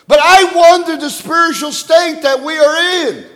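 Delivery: high-pitched, insistent pulpit exhortation; impassioned preaching. Real, not acted. Preacher exhorting believers to focus on the judgment seat, impassioned preaching. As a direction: press the congregation hard, pitched high.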